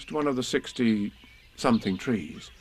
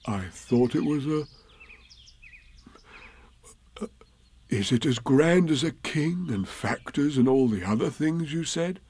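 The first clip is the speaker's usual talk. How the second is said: higher, hesitant bear voice; Pooh, puzzled bear; 5 semitones higher than usual. Acted. Story figure Pooh asks about knights.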